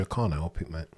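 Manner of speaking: ordinary talk, nothing marked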